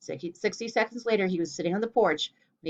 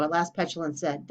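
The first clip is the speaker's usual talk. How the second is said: ordinary delivery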